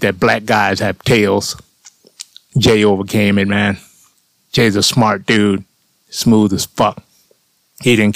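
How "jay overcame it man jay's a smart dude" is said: ordinary talk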